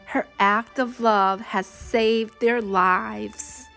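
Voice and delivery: plain reading voice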